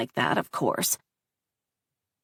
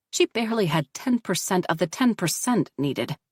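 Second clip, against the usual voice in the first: plain reading voice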